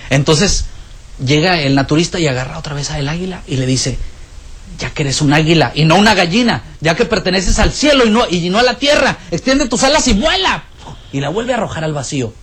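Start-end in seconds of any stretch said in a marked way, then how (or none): 4.8-10.6 rising command. Acted. Naturalist holding the eagle on the roof: building, rising command.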